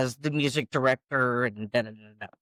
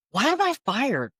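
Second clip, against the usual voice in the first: high, incredulous protest; indignant disbelief; 10 semitones higher than usual. Retold or imitated. Singer questions the boss who fired her, indignant. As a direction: retell it as a stunned objection.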